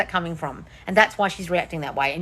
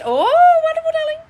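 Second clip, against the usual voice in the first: much higher oh, wonderful, darling; gushing delight; 6 dB louder than usual. Real, not acted. Host gushes at a viewer's engagement news. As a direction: gush over the good news.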